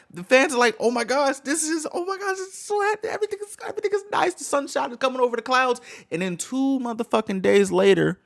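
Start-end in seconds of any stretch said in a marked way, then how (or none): 0.8-5.8 mock the fans' sugary joy; gushing fan. Streamer mocking fans gushing over idol's return, giddy.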